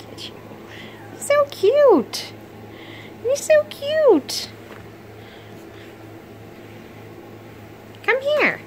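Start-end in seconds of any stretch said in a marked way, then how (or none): 1.1-8.6 high, slow drawn-out cooing; baby talk to a pet. Acted. Owner admiring the puppy, slow high cooing baby talk. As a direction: stretch it out sweetly for the puppy.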